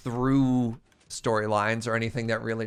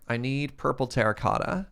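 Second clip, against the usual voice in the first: ordinary delivery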